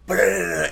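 sputtering engine noise. Retold or imitated. Telling a friend how the mower blew, mock engine sputter. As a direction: spit it out like a choking motor. Imitating mower spitting oil.

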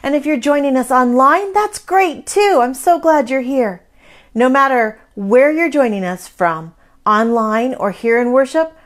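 earnest upbeat school lecture. Acted. Children's time greeting to kids.

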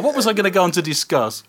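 ordinary talk, nothing marked

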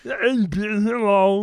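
usual speaking voice

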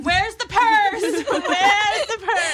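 high, loud, laughing panic about the purse; mimicking frantic asking. Friend mocking her drunk friend's purse panic, high laughing squeal. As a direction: squeal it like you've lost everything. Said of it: "it's like".

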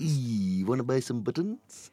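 lightly voiced cartoon cat hustler; a hint of a streetwise cartoon cat; Top Cat voice. Repeating his Top Cat line for a friend, lightly voiced. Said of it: "what was the last bit you just said".

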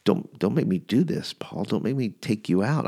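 soft, low mock menace; threatening. Assassin excuse warns Paul against getting up, mock threatening. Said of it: "It was very threatening".

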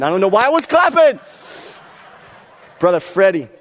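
high, laughing, losing composure; real laughter breaking the sermon; cracking up. Real, not acted. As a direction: give in to the giggles mid-sermon.

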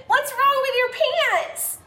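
high, loud shriek with laughter. Acted. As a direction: squeal in shocked delight. Girl shrieking at pants.

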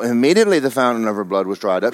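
ordinary talk

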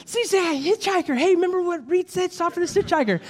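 high-pitched, hammed-up eagerness; mock eager follower. Churchgoer spotting a hitchhiker after the sermon, mock eager. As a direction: raise the voice high, pretending naive excitement.

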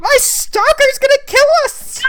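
shrill high mock panic. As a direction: squeal it in fake terror. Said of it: "Katie's like".

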